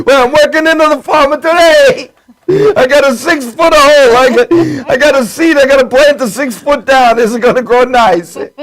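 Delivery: high-pitched Sicilian farmer voice; Sicilian accent. Acted. Host mimics his Sicilian family farming, Sicilian accent. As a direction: do a put-on Sicilian farmhand. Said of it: "That's about the only good accent I can do".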